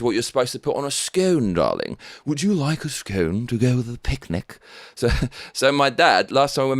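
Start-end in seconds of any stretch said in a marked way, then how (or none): 2.2-4.6 hammed-up posh English hostess offer; a touch of upper-class English; mock posh English.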